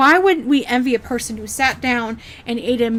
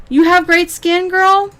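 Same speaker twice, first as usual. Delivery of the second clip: higher, a touch louder sneering parrot; mocking echo; 7 semitones higher, 4 dB louder, slower than usual. Vlogger mocking the streamer's claim about her skin, high sneering echo. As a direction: throw her own words back at her. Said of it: "And then you talk about your skin".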